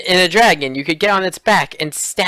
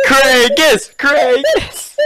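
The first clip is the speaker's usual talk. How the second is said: loud, high cheer of the name; whooped name-call; excited cheering; 9 semitones higher, 3 dB louder, much slower than usual. Real, not acted. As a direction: chant it like a thrilled fan. Cheering the character's critical hit.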